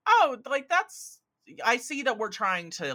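hammed-up prissy critic voice; mock snooty reaction. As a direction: send up the clunky script with a prissy squeal. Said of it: "it can be a little like".

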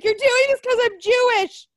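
high-pitched wail of accusation; mock indignant protest. Imitating woman protesting to the cop arresting her, mock indignant protest.

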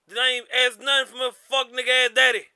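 normal talking voice

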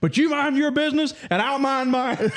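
high-pitched imitation of a cross old man; retold squabble played for laughs; mock indignant retort. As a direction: act out a testy comeback, drawing out the end. Old man snaps back at dad.

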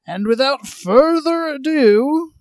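loud announcer attempt. Shown as a demonstration. Host trying out the lost announcer voice, loud. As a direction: project a big show introduction. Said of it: "you have an announcer voice".

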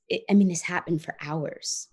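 normal talking voice

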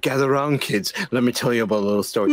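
low, fast campfire storyteller; hushed creepy legend telling. Acted. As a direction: lure kids into a spooky tale. Spooky storyteller bit.

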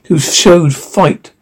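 usual speaking voice

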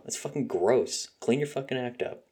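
normal talking voice

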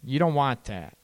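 ordinary delivery

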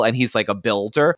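ordinary talk

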